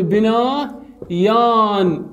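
بنايان stretched slowly, part by part; drawn-out demonstration. Shown as a demonstration. Teacher shows students the dual, slow drawn-out demonstration. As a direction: draw out each half of بنايان.

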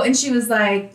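ordinary delivery

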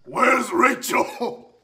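slow, rough superhero growl; Batman. Acted. Prankster playing along with teammate's guess, superhero growl. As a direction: growl it out, dragging each word. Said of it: "that's Batman".

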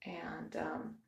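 hushed real whisper. Real, not acted. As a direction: whisper so nobody wakes up. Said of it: "I'm trying to be quiet 'cause my family's still sleeping".

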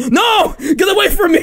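very high, frantic refusal; mock angry shout. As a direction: shriek to drive off a pest. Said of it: "getting angry".

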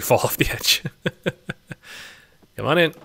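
ordinary delivery

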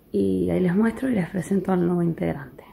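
real whisper from a sore throat; barely voiced, ill and tired; sick-voiced whisper to the camera; hoarse sick whisper. Real, not acted. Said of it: "sigo con la voz así".

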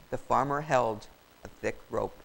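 plain reading voice

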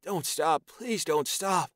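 high, breathless begging; voiced young man pleading, pitched high; desperate pleading. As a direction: beg him not to stop. Tyon pleading in climax.

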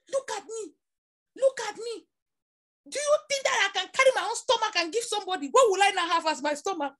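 high, loud, rushed protest; indignant retold outburst. Retelling a cousin confronting Papa, indignant outburst.

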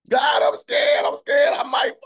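crying out high and loud in fear; kicking and screaming. Acted. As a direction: wail it like a scared child begging God. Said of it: "kicking and screaming".

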